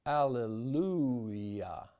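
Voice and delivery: slow Southern alleluia with a drawled diphthong; Deep South accent. Shown as a demonstration. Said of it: "in the Deep South".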